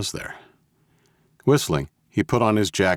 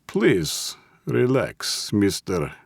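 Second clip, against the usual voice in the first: put-on higher, courteous Russian accent; heavy Russian accent; 4 semitones higher, much slower than usual. A narrator's reading. Russian boss asking the old man's name, heavy Russian accent. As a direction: soothe politely in Russian-accented English. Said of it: "in a heavy Russian accent".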